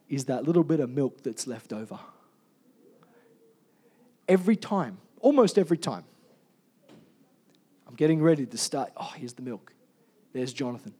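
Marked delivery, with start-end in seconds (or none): none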